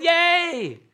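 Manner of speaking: high, loud mock cheer; crowd cheer. Preacher voicing the crowd at the falls, mock cheer. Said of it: "the crowd is clapping and, and yelling".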